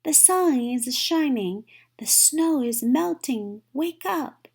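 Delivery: high, loud and bright news; eager calling. A narrator's reading. Frog urging Toad to get up, excited and loud. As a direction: coax a sleepy friend out, loudly.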